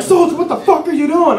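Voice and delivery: incredulous acted challenge; sergeant confronting soldier. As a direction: imitate a startled, scolding sergeant. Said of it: "my sergeant stops me".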